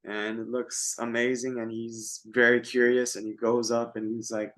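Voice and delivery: normal talking voice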